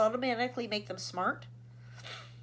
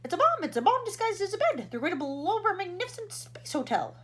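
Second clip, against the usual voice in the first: high, loud alarmed outburst; 10 semitones higher, 7 dB louder than usual. Acted. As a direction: sound the alarm. President panics over bomb.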